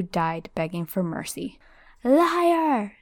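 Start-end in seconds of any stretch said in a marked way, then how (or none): 2.1-2.9 high shouted accusation; Harry hurling the word at Voldemort. Acted. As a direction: fling it back hard. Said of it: "shouted Harry".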